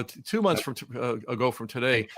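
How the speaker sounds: ordinary talk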